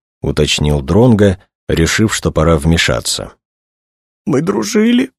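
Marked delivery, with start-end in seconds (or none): 4.2-5.1 very high, tearful squeak; sobbing. A narrator's reading. Young woman recalls her murdered friend, sobbing. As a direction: sob the words out, pitched far up. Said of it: "всхлипнула она".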